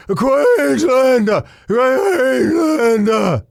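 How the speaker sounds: "Queenslander" drawn out high and slow, like a local; "Queenslander" stretched slowly, high and called out; Queensland accent try. Shown as a demonstration. Host asking how to say it, draws out "Queenslander" slowly. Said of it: "say, how do you say".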